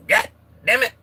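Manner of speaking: high, loud mock cursing; mock outrage. Streamer voicing a viewer's comment, mock outrage.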